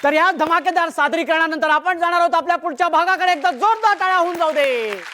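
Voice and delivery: high, fast show-host patter; announcer. Real, not acted. Host sends show to next segment, fast high announcing. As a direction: race into a rousing segment link.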